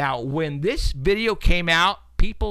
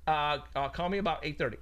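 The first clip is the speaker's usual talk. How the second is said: quiet phone aside; 2 semitones higher, 12 dB quieter than usual. Real, not acted. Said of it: "I'm kinda busy right now".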